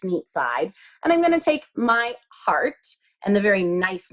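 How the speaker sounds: ordinary talk, nothing marked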